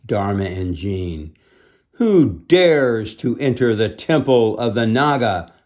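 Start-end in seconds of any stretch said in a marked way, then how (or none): 1.9-5.5 menacing challenge. Acted. Twin masters challenge intruders.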